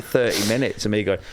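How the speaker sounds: normal talking voice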